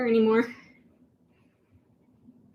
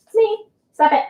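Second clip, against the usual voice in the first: playful scold; 10 semitones higher, 3 dB louder than usual. Real, not acted. Cat messing up school paper.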